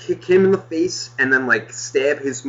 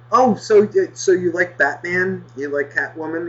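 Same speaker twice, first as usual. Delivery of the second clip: higher, mock-friendly grown-up tone; talking to a kid; 4 semitones higher than usual. Shopper politely asking the kid, higher kid-friendly voice. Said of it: "I look at the kid and I go".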